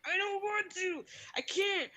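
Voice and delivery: high, petulant mock whine; whining child imitation. Pupil refuses the teacher's task, whining high. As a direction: refuse like a stubborn kid.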